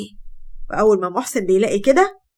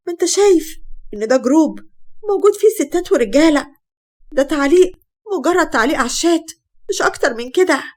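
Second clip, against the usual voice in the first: raised, urgent pleading to be believed; desperate pleading; 6 semitones higher than usual. Acted. Wife pleading with her jealous husband, urgent and raised. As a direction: plead your innocence, voice pitched up.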